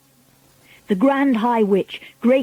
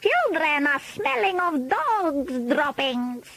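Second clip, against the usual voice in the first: high, slow witch voice full of disgust; Grand High Witch; 7 semitones higher, 5 dB quieter, slower than usual. A narrator's reading. Witch leader telling her audience children stink, disgusted villain voice. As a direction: draw out each nasty word up high.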